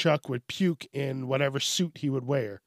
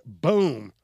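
ordinary talk

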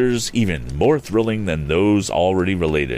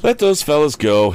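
put-on high young hero voice; young inventor advising; 10 semitones higher, 5 dB louder than usual. A narrator's reading. Tom advises dropping the chase, high firm voice. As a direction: advise firmly, louder and pitched up. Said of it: "advised the young inventor".

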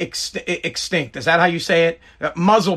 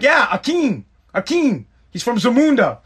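higher-pitched, emphatic explanation; raised, playful insistence; insistent joking dad; 5 semitones higher than usual. Retold or imitated. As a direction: insist on the joke as if it's obvious. Insisting on his movie joke.

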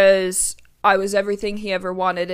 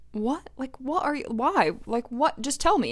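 higher, softly pressing him for an answer; retold pleading, pitch lifting on each question; insistent questioning; 4 semitones higher, 4 dB quieter than usual. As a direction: coax it out of him, half exasperated.

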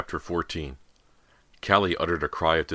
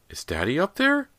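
put-on high, louder child voice; little boy's question; 14 semitones higher, 5 dB louder than usual. Boy wiping tears asks his mother, high child's voice. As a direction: ask it high, like a worried child.

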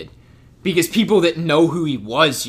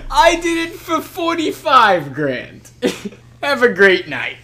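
high, slow exasperated yelling; Seth Rogen yelling; 9 semitones higher, 4 dB louder, slower than usual. Retold or imitated. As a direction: berate the crowd, pitched way up. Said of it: "he's like".

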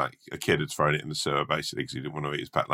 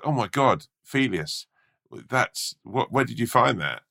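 higher-pitched, amazed rat voice; acted astonishment; 7 semitones higher than usual. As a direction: play a rat stunned by a find. Wiser rat astonished at gold.

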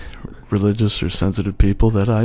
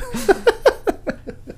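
high breathy laugh; 6 dB quieter than usual. Real, not acted. Laughs after story.

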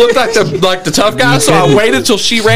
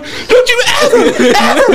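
mock furious dad; 11 semitones higher than usual. Dad beating mouse, retold.

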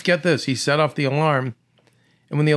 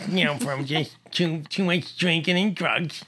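high, slurred, toothless mumble; Louis's wasted voice; 5 semitones higher than usual. Retold or imitated. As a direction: explain it in the drunk's slack, toothless voice. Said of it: "he had that voice".

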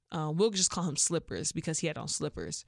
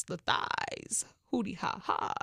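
playful whisper; 3 dB quieter, slower than usual. Real, not acted. Teasing aside about her hips.

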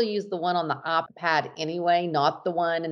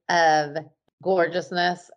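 ordinary delivery

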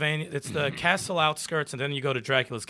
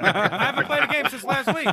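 mock exasperated; 12 semitones higher, 5 dB louder than usual. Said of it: "I was like".

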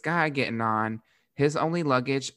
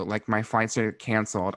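ordinary delivery

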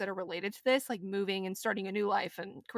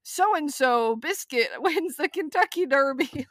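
news alert voice; 5 semitones higher, 5 dB louder, slower than usual. Retold or imitated. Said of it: "And it was like".

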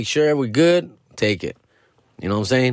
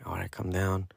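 much softer, low self-talk; hushed imitation of settling down; calming himself; 3 semitones lower, 13 dB quieter than usual. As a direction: talk yourself down quietly. Calming himself after outburst.

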